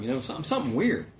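usual speaking voice

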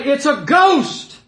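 high, loud, drawn-out cry; terrified outcry. Acted. Preacher voices disciples seeing Jesus, loud alarmed cry. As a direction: yell it out slowly in acted alarm. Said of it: "There's an exclamation point there".

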